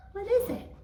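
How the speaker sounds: ordinary delivery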